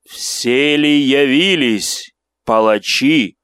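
slow, loud, booming unearthly voice; inhuman voice. Acted. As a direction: boom it out like a monster. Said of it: "Это не был голос крысолова ... вообще был человеческий голос".

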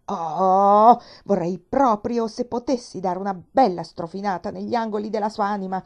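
loud, indignant grumbling. A narrator's reading. Nancy vents about her mistress while scrubbing, loud indignant grumble.

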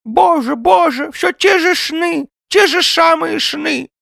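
high, loud, slow lament; lisping old man wailing a complaint; plaintive old man. A narrator's reading. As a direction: drag out the woe in a toothless high voice. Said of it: "Произнеся эту жалобу, старик заплакал".

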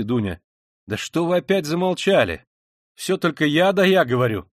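high, animated, insistent chatter; agitated liveliness. A narrator's reading. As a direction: press them with restless energy. Animated man orders friend to stay, prods others.

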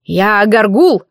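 slow, puffed-up pride; proud. A narrator's reading. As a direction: announce yourself proudly. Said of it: "гордо выпятил грудь".

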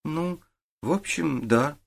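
reluctant grumble. A narrator's reading. Boy admits it to the detective, grumbling. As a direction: drag out a grudging admission. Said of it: "пробурчал Олег".

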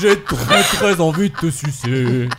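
slow, laughing trash can voice; talking trash can voice. Pretend. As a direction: play a cheeky talking bin, slowly. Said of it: "La poubelle parlante".

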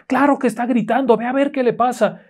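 insistent high-pitched aunt; acted calling out. Aunt sends the boy to check on his sister, calling out. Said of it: "le está gritando".

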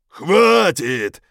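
loud, high barked command. A narrator's reading. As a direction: cut them off sharply. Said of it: "вдруг приказал старший".

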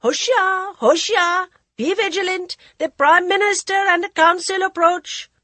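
high, loud herald's call; eunuchs calling out. A narrator's reading. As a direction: cry out the announcement, high and loud. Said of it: "The high voices of the eunuchs had echoed".

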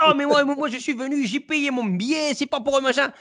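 high, hammed-up Marseille accent; indignant spectator in a mock Marseille accent. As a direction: play the front-row guy with a big Marseille accent. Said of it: "le gars avec l'accent de Marseille".

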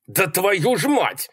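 slow, high-pitched cry; high, furious outburst; exasperated curse. A narrator's reading. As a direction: draw out the curse in frustration. Malcolm curses as she crosses.

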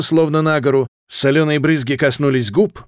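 ordinary delivery

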